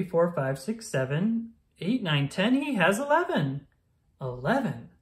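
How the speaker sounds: ordinary delivery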